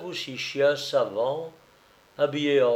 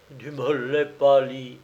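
ordinary delivery, nothing marked

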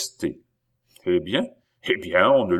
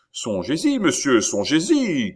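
raised-pitch, urgent warning; cried warning; 7 semitones higher, 4 dB louder than usual. A narrator's reading. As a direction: call after him in mild alarm. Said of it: "criait le maître de poste". (avertissement crié, voix haussée)

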